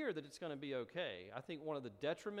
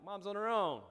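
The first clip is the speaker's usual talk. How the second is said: higher and louder, tossed-off dismissal; mock gleeful retort; 7 semitones higher, 8 dB louder than usual. Student answers the resident about Mom, mock gleeful retort. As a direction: brush off the resident with gleeful bravado.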